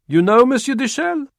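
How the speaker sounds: high, loud reporter's voice; a new reporter calling a question; French reporter. Acted. Reporter questioning the star, high and loud.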